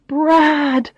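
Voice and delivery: Brad! as a startled thought; sudden realization. Acted. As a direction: catch the name as it dawns on you. Narrator realizes Brad is coming.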